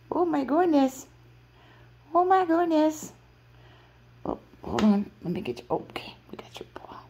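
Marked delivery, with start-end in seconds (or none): 0.1-3.1 high, loud delighted squeal; excited. Real, not acted. Excited by the pets' play.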